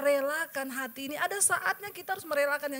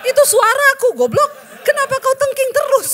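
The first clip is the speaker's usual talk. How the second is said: very loud, high exasperated shout; Tuhan scolding; 11 semitones higher, 16 dB louder than usual. Retold or imitated. Preacher voicing God reproaching her for rebuking Him, exasperated shout. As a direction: bellow a comic, full-force telling-off. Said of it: "Tuhan bilang".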